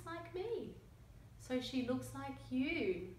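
lively picture-book read-aloud with character voices; reading to children. A narrator's reading. Reading a picture book to young children, lively storytelling. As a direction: tell the story to little listeners.

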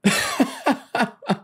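high, falling burst of laughter; helpless laughter. A narrator's reading. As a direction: laugh until you can't stop. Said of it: "Keith was killing himself laughing".

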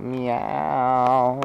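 a drawn-out meow in a cat voice; imitating a cat meow. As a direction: mimic the cat asking to go out. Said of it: "he'll meow".

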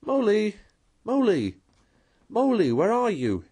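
high, loud cheerful calls into the woods; hollering for a lost friend, bright and hopeful; calling cheerfully. A narrator's reading. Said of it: "calling out cheerfully".

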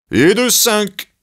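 high, loud, slow victory shout; Zeus yelling in triumph; triumphant cry. Acted. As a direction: crow it out in triumph. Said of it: "cria Zeus triomphalement".

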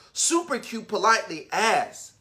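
usual speaking voice

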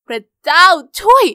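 loud, slow horrified exclamation; shocked gasp. Acted. Girl sees the bungee tower, loud shocked cry. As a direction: stretch it out in disbelief.

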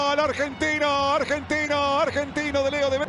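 elongated ecstatic goal call; goal scream. Real, not acted. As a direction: stretch out the goal shout. Goal call after penalty.

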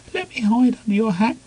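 high frightened squeak. A narrator's reading. Penguin Small begging the snowman, high squeak. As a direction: squeak it out in panic. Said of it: "squeaked Penguin Small".

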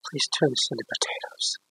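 breathy unvoiced plea; bishop's hushed whisper over the meat; whispered prayer. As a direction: whisper it like a secret prayer to God. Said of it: "he whispered the prayer".

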